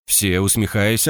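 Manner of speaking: ordinary delivery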